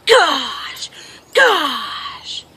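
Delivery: high falling exclamations; drawn-out, falling cries of frustration; self-berating boy. Retold or imitated. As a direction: imitate a frustrated child scolding himself. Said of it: "began to hit himself in the leg and going".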